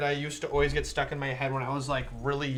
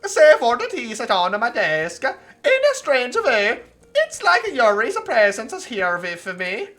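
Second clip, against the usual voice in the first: very high, loud and slow put-on girl voice; awful put-on voice; 9 semitones higher, 11 dB louder, slower than usual. Streamer reading the visual novel aloud, silly high voice. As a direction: squeal the game's lines in a silly high voice. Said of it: "this fucking awful voice".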